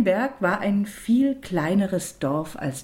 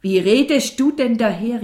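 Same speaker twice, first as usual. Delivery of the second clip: louder, higher indignant rebuke; annoyed exclamation; 4 semitones higher, 7 dB louder than usual. A narrator's reading. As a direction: call out crossly at the child. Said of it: "rief die Mutter ärgerlich". (ärgerlich rufend)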